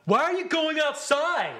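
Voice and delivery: high, loud imitation of moviegoers; loud mimicked chatter. Mimicking noisy moviegoers talking over the film, loud and high. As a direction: drag out a mock-loud question.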